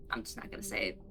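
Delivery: a sly whisper to yourself; whispered aside. Real, not acted. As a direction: whisper it, barely voiced. Dodging a personal question.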